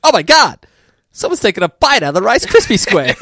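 high, loud mock outrage; imitating Wiggum. Quoting the police chief, loud high mock panic. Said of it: "Wickham's response".

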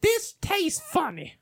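high, slow mock complaint; old lady customer. Old lady returning her burger, high slow imitation. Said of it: "This old lady comes up".

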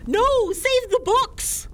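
high, slow, urgent yell; panicked shout. Real, not acted. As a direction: cry out to save the books. House on fire, panicking.

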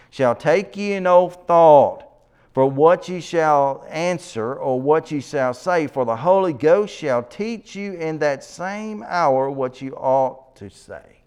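emphatic scripture reading. Acted. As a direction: declaim the verse from the pulpit. Reads scripture aloud.

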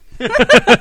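short, high, loud laugh; quick loud burst of amusement; real laugh. Real, not acted. Reacting to the clip.

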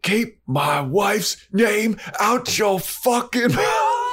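high, screaming mock rage rising to a shriek; furious shout. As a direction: scream it at him, hammed up. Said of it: "he runs over to Angel's like".

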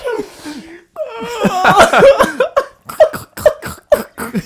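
wheezing, gasping laughter; hysterical laugh. Real, not acted. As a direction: laugh like sprinting through slush. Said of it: "you sound like somebody who's sprinting through slush".

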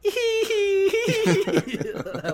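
high, laughing, stretched out. Real, not acted. Co-host loving the God voice, laughing high and stretched. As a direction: crack up while saying it. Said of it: "I love that voice".

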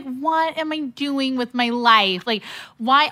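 high, slow exasperated wail; mock despair. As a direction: whine at yourself, half joking. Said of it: "I was just like".

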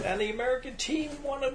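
high, sing-out newsreader voice; TV news reporter. Retold or imitated. Retelling a TV reporter spoiling the hockey game, high mock newsreader. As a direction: imitate a reporter announcing the result. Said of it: "some stupid Washington Channel seven reporter".

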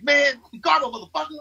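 imitating his co-star snapping in the scene; retold snapping. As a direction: fire it off like a fed-up co-star snapping. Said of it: "he, like, really just snaps".

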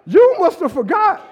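high, loud voice of God mocking a forgetful Israel; God scolding his people, high and loud; voicing God. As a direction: speak as God, teasing and pointed. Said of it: "He's like".

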